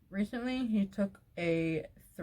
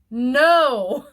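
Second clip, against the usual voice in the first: loud, high, drawn-out no; emphatic refusal; 5 semitones higher, 13 dB louder than usual. Real, not acted. Rejecting his insisting on going, loud emphatic cry. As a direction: shout it in exasperation.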